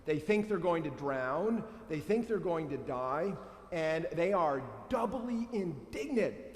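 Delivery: ordinary delivery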